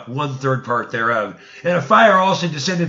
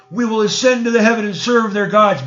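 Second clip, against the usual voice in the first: rebel's boast; 7 semitones higher, 5 dB louder than usual. Acted. Rebel group's vow, quoted again.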